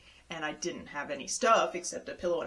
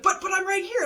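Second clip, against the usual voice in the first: indignant protest; 12 semitones higher, 7 dB louder than usual. Retold or imitated. Pleading at the closed gate.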